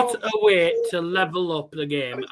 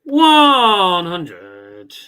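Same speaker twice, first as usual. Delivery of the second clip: darts caller voice; 9 dB louder than usual. Pretend. Mimics darts caller announcing score.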